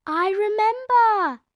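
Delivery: put-on high slow proud child; proud Peppa. A narrator's reading. Peppa telling parents she remembers, high. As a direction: boast happily. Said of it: "cried Peppa".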